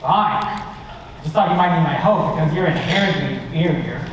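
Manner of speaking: usual speaking voice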